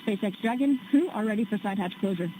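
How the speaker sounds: crackly radio transmission, high and loud; crisp call over a comm loop; astronaut radio. Real, not acted.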